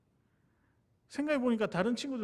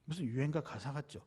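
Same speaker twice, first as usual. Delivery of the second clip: ordinary talk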